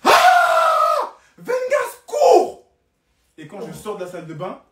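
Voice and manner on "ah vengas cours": very high, very loud panicked yell; friend's yell. Retold or imitated. Friend yelling to Vengas to run, very high and loud. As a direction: scream it across the house to warn him. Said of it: "un de mes potes qui crie".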